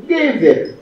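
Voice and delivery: Gever with GE high, then falling; stress on first syllable. Shown as a demonstration. Teacher giving an exception example, first syllable stressed. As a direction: punch the GE of Gever. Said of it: "l'accent visible pour que tout le monde".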